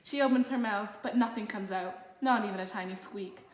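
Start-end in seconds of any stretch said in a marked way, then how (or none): none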